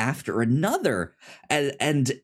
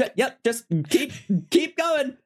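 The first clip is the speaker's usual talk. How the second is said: high, breezy guide voice, much higher than his own; chipper Benji; 14 semitones higher than usual. Retold or imitated. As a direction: play Benji cheerfully steering him along. Benji again.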